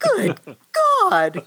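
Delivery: high shocked outburst on "Good"; thunderstruck cry. Acted. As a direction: blurt it out, scandalised. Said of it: "cried the doctor, thunderstruck".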